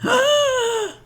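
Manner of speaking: sharp high gasp of delight. Real, not acted. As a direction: gasp in awe.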